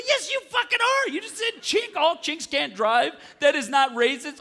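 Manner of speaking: high-pitched, exasperated comic accusation; retold outrage. Passenger accusing the driver of racism, outraged. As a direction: throw it back, high and indignant. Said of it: "I go".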